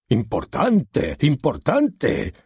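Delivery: slow, drawn-out mocking importante; mocking repeat. Director mocks the author's word importante, slow and sneering. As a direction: stretch out importante to ridicule it.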